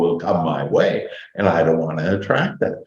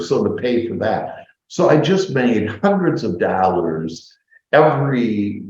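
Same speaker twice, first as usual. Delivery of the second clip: ordinary talk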